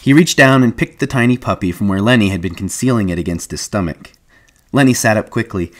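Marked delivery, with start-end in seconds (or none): none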